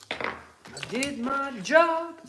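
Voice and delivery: high, very slow, stretched out; drawn-out sing-song. Acted. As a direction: drag it out like a mock proud boast. Repeats his line, drawn out.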